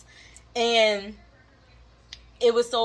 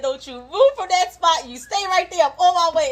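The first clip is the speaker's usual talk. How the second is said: mock yell, higher and louder; angry mom order; 8 semitones higher, 7 dB louder than usual. Mom on the phone telling her daughter not to move, hammed-up yell. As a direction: shout the order like a furious mother. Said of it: "She said".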